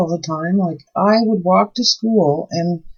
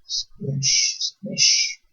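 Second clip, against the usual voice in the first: breathy playful whisper of a sound word; whispered squish; 7 dB quieter than usual. Real, not acted. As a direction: whisper it while squeezing the piping bag. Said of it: "piping icing, playful sound word".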